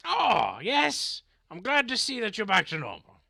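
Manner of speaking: high, bright, eager greeting; cheerful character voice. Acted. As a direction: greet him gladly, in character. GM voices Gornwick.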